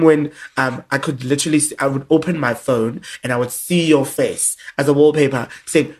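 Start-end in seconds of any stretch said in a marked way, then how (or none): none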